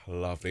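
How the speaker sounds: deep audible sigh. Shown as a demonstration. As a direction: let out a long sigh. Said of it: "Nice deep breaths".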